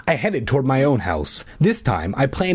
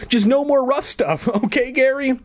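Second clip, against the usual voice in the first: very high placating squeal; pleading cry; 13 semitones higher than usual. A narrator's reading. Bully giving in to the boy, high pleading cry. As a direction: give in with a high-pitched cry. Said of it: "Marv cried".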